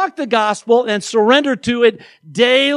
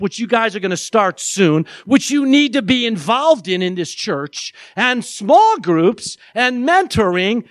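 normal talking voice

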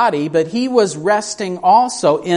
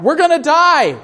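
higher and louder, a panicked pilot screaming; mock scream of panic; 11 semitones higher, 3 dB louder than usual. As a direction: scream it like the plane is going down. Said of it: "the pilot is screaming out".

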